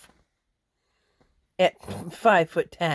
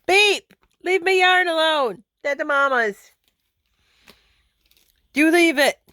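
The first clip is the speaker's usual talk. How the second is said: high, loud command to a pet; scolding a pet; 10 semitones higher, 5 dB louder than usual. Real, not acted. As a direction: shoo the pet off in a raised, high voice. Scolding pet off her yarn.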